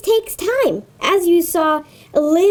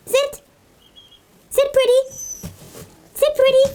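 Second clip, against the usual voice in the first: high repeated dog command; coaxing command; 7 semitones higher than usual. Real, not acted. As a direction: patiently coax the dog.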